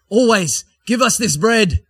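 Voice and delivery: loud, high eager begging for bread; pleading crowd. Acted. Crowd asks Jesus for bread, eager loud plea. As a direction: crowd calls out, hungry and eager.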